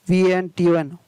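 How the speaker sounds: normal talking voice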